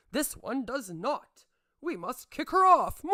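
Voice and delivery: slow, then a loud, high shouted order; hammed-up villain decree; mock villain shout. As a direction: bellow like a cartoon tyrant.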